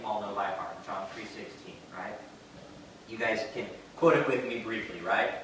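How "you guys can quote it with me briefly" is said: normal talking voice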